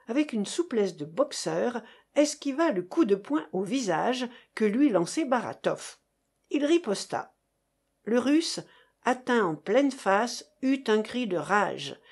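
ordinary delivery, nothing marked

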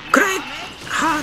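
high shrieked battle cry; screaming attack name. Acted. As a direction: scream the attack with full force. Attack shout. (高く叫ぶ掛け声)

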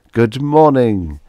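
slow, loud, happy good morning; happy greeting. Acted. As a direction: greet them happily. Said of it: "the Martian said happily".

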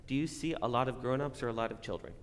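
normal talking voice

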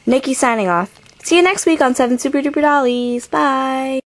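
ordinary talk

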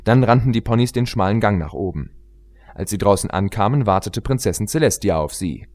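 usual speaking voice

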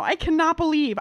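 ordinary talk, nothing marked

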